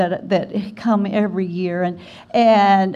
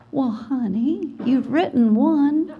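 slow, higher, dry mock-mother; a mother's deadpan; 6 semitones higher, slower than usual. Mother on the phone from Alabama, slow deadpan. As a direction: let the put-down land slowly.

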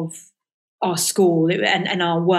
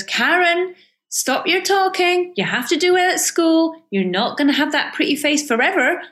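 higher-pitched mock Scottish teacher scolding; Missus Mackenzie's Scottish accent; 8 semitones higher, 3 dB louder than usual. Teacher scolding chatty Karen in class, in a Scottish accent. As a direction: put on a Scottish accent, telling her off with a wry edge. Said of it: "but excuse my Scottish accent".

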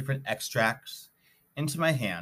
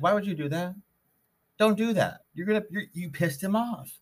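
higher-pitched friend voice, urgent and scolding; alarmed friend scolding; 10 semitones higher than usual. Retold or imitated. Friend warns speaker after posting, high-pitched scolding. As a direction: friend warning him off in a raised voice.

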